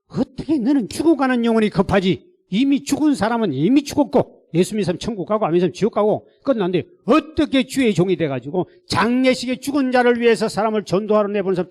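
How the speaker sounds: high, loud divine scolding; thundering rebuke. Retold or imitated. God rebuking pastor over funeral, thundering scolding. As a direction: hammer the accusation home as God. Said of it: "호통을 치는 거예요".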